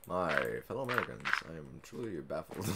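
soft, fast presidential address; hint of a president speaking; president speech.

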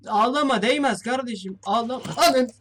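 loud mock comfort; mock consoling shout. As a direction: call out to the crying ghost, hammed up. Shouting at a crying ghost.

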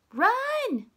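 playful mock yell of run; mock shout. Shown as a demonstration. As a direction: yell it as a joke. Joking fake scare shout.

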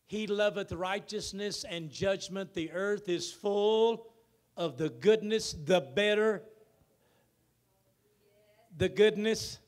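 loud, high preaching with drawn-out words; full-force preaching. Acted. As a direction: proclaim it to the whole church. Loud scripture reading, slowed.

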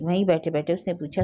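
ordinary delivery, nothing marked

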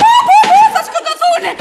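very high, loud wail of alarm; panicked cry. Acted. Woman watching two people fight, panicked cry. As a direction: shriek that they will come to harm.

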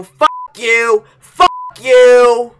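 angry shout. Real, not acted. Gamer cursing the game over and over, angry shout. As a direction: curse it at full rage. Repeated curse building into a shout.

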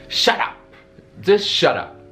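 normal talking voice

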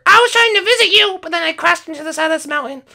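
put-on very high kid character voice; Skittles voice. Skittles explaining his crash to Dad, very high kid voice. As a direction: chirp it like an eager young son.

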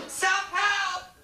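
high yelled cry of self-help; shouted punchline. Acted. Emcee yelling the title at the crowd, shrill high shout. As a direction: yell it up high like a sudden revelation.

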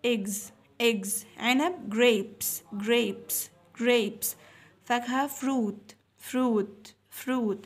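slow, emphatic word-by-word vocabulary drill; teacher drill voice. Shown as a demonstration. Teacher drilling food words for young pupils, slow and emphatic. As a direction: drill each word so children echo it.

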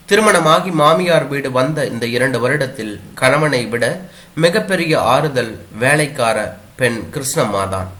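ordinary delivery

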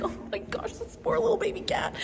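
soft breathy whisper of pity for a cat. Retold or imitated. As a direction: whisper it like you've found a poor kitten.

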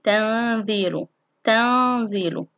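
slow تنزيل with long nasal noon; ikhfa demonstration. Shown as a demonstration. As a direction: hum the noon before the ز of تنزيل. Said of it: "حرف الزاي". (إخفاء النون بغنّة ممدودة في تنزيل)

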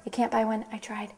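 muttered aside. Acted. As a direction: drop the voice for a confidential aside. Muttered aside within news script.

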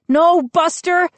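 high, loud desperate cry; shrieking. A narrator's reading. Brother stopping the dog over chants, shrieking. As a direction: shriek it over a noisy crowd. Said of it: "I shrieked".

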